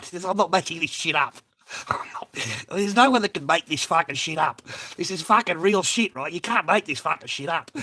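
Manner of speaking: worked-up protest; insistent. Real, not acted. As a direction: swear it's all true. Insisting the story is true.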